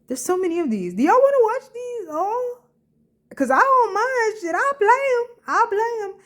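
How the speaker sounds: high giddy excitement; excited. Real, not acted. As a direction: hype up the viewers. Excited offer to viewers.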